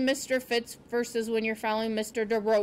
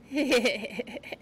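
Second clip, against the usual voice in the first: laughing. A narrator's reading. Porcupine amused by the owl's name, laughing. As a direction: chuckle in character. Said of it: "he began to laugh".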